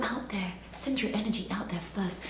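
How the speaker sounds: soft unvoiced whisper; hushed confidential advice. Shown as a demonstration. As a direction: whisper it like a secret tip. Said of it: "demonstrating intimate delivery".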